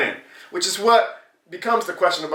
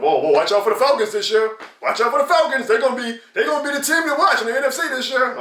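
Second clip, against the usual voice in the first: higher, hammed-up hype; mock media hype; 8 semitones higher than usual. Vlogger mocking national media hype, higher mock voice. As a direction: sell the Falcons hype mockingly. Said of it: "national media too is hyping up".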